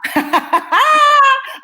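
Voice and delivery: loud, high burst of laughter. Real, not acted. Telling an audience how friends saw it, high amused laugh.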